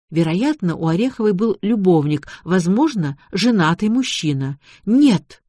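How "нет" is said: sudden higher, louder protest; flaring up. A narrator's reading. Mother rejects talk of her daughter's lover, flaring up. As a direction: flare up on one sharp refusal. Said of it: "вдруг взвилась старуха".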